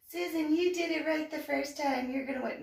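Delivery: high put-on voice of a rival's flattery; mimicked fellow contestants. Voicing the other female contestants.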